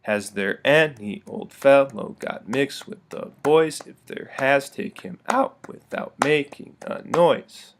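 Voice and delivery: slow, da-da-DUM stress on each beat; anapestic reading. Shown as a demonstration. Teacher reads poem to model anapestic rhythm. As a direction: build each line toward its strong beats. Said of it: "read with anapestic rhythm".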